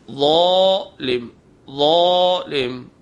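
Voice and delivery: ظالم stretched very slowly with a heavy, velarized z, no hiss; heavy z without whistle. Shown as a demonstration. Teacher demonstrating ظالم with the heavy letter, slow and emphatic. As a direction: draw out ظالم heavy, tongue tip on the upper teeth. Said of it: "آواز کو بھاری کریں گے بغیر سیٹی کے".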